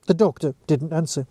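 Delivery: usual speaking voice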